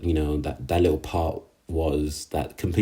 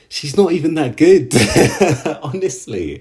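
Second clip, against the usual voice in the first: laughing through it, higher and louder; 8 semitones higher, 6 dB louder than usual. Real, not acted. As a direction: burst into a laugh as you say it. Laughing mid-rant at a character.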